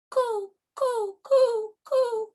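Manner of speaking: very high pigeon coos; pigeon cooing. Acted. Story's pigeons answer.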